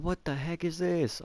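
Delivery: put-on high, slow, shrieking kid voice; little kid screaming. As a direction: imitate a small kid yelping in shock. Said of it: "He was, like, a little kid".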